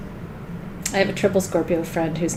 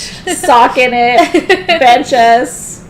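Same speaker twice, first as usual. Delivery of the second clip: joking retort; 4 semitones higher, 11 dB louder, much slower than usual. Real, not acted. Joking with the crew.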